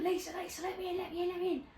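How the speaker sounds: acted-out frantic plea, kept soft; retold panic. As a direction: urgently beg in a small high voice. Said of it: "absolutely panicking".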